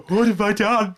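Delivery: high, loud, hammed-up wail of regret through laughter; mock-tragic cry, laughing through it; mock Anakin despair. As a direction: ham up a tortured hero's lament. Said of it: "and he's just like".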